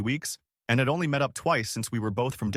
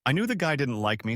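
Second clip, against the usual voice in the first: usual speaking voice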